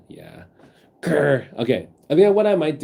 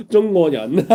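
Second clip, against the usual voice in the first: playful; 8 semitones higher, 7 dB louder than usual. Pretend. Playful Chinese phrase said laughing.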